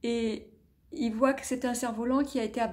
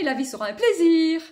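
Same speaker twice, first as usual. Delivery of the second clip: higher, louder happy cry; joyful; 6 semitones higher, 8 dB louder, faster than usual. Acted. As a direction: sing out the promise. Child's joyful promise.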